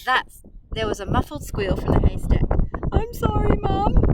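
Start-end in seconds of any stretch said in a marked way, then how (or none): 2.9-4.0 much higher, little piglet voice; a tiny sorry child's voice; small voice. A narrator's reading. The piglet apologising to his mother in a small voice. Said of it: "said a small voice".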